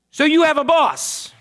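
high, loud mock yell; yelling back. Irate caller challenges the supervisor, acted yelling. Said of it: "the person yells back".

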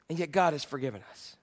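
quiet reverent statement; softly said. Real, not acted. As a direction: let it land gently. Hushed repetition of key line.

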